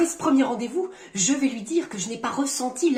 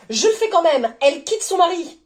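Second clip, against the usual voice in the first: higher and louder, insisting; defiant irritation; 6 semitones higher, 5 dB louder than usual. Acted. Reader overriding a partner's objection, defiant and irritated. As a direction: push on over the objection, sharply.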